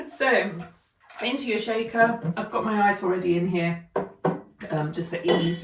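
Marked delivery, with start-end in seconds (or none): none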